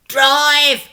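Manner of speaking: high, loud crook's bark; shout. Acted. Crook Thumbs yells at the getaway driver, a loud high shout. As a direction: yell at the driver to go. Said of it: "shouted Thumbs".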